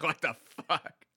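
loud, slowed, laughing question; laughing disbelief. Retold or imitated. Reacting to the bird hitting his horse, laughing. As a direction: stretch it out through a laugh.

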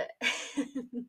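short quiet breathy chuckle; a soft laugh to yourself at the memory; amused laugh. Real, not acted. Podcast host looking back at her school story, amused laugh. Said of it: "I just laugh, like, looking back at this".

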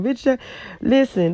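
usual speaking voice

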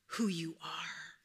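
father's voice fading from the sky; fading whisper. Acted. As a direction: let it drift away like a spirit. Mufasa's fading voice.

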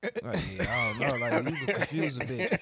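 mock-whimpering spouse over dinner; mock crying wife. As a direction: play the wife near tears, hammed-up. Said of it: "I'd have been crying too".

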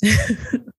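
short guilty-pleasure giggle. Real, not acted. Reader about to confess her latest book, giggling. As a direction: giggle before confessing what you're reading. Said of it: "As she giggles".

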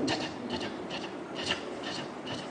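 very soft, voiceless clicking "kya-kya"; imitated "kya" noise. Preacher imitating a noise in the story, soft voiceless "kya-kya". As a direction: tick out tiny toneless repeated syllables.

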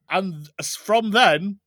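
usual speaking voice

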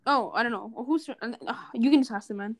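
ordinary delivery

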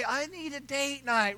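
soft slow mock of his wife; lightly voiced pleading wife; wife impression. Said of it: "she'll say".

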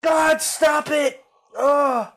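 high furious yell; angry shout. Real, not acted. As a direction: shout at the pestering messages. Yelling at phone messages.

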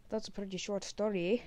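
usual speaking voice

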